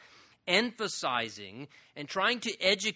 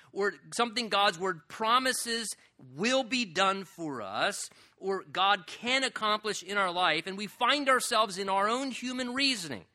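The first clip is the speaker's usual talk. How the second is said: usual speaking voice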